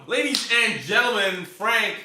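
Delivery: high, loud, slow announcer. Acted. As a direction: boom it like a ring announcer. Announcing the stream start.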